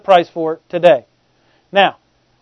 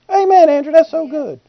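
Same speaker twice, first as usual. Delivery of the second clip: higher-pitched congregant's amen; mock approving listener; 7 semitones higher than usual. Congregant praising the preacher's point, mock approving. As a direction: put on a high, admiring churchgoer.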